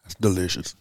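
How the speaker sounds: savoring after a sip; while sipping a drink. Real, not acted. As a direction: lips still wet from the whiskey. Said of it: "take a sip of my root beer whiskey".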